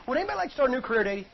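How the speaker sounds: rattled off fast, a throwaway aside; quick question. Shown as a demonstration. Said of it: "Think about this".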